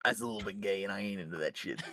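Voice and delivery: low, quiet New York accent. Real, not acted. Deadpanning a refusal to cuddle, in a New York accent. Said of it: "With a fucking little New York accent".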